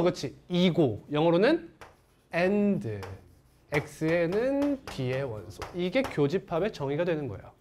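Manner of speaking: ordinary delivery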